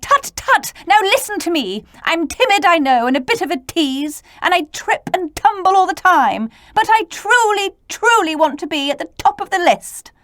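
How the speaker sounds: high, bright-pitched character voice, quick in places; T pleading to be first; eager little T. Acted. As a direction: play a fussy, eager little letter.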